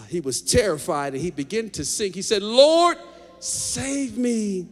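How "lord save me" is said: high, loud cry for rescue; desperate shout. Acted. Sinking Peter shouting to Jesus, high and loud. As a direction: yell it as you go under. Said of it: "He shouted".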